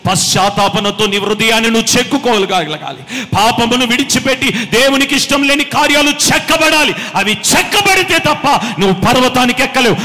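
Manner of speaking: loud, driving sermon voice; preaching at full force. Acted. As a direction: preach it hard to the congregation. Preacher exhorting congregation.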